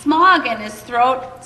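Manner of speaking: a distressed little creature crying out at the Onceler; croaking Lorax cry. Acted. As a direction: cry out high and croaky, half sobbing. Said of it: "he cried with a crofulous croak".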